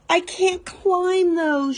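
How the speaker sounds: slow, high, drawn-out wail; a small girl facing tall rungs, wailing she can't climb. A narrator's reading. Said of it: "she wailed".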